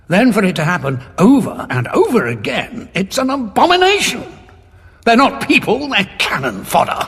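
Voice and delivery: rising to a loud, high outcry of disgust; outraged. Acted. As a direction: let the revulsion burst out. Outraged at reviving the dead.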